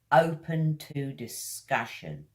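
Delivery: ordinary talk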